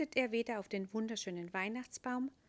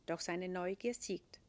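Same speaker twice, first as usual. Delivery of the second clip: usual speaking voice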